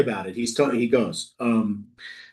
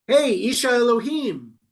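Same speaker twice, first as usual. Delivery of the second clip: higher-pitched, cheeky hail; insulting address; 6 semitones higher than usual. A narrator's reading. Captain rudely hailing the prophet, insulting. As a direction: call out to him disrespectfully. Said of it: "it's much more insulting".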